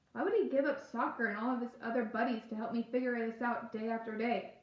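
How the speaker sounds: ordinary delivery, nothing marked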